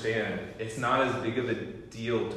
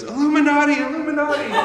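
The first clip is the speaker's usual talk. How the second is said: high, loud hammed-up shriek of Illuminati; mock scream; 13 dB louder than usual. Mock scream of a man fleeing McDonald's. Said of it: "runs out of McDonald's screaming".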